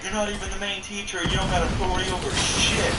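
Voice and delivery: high defiant yell. Retold or imitated. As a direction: shout it back while walking away. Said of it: "I actually yelled".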